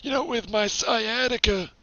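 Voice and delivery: lightly voiced old man; rambling old-timer complaining. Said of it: "trying to keep the old man voice".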